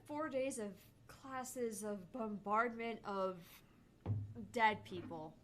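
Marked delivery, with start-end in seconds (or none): none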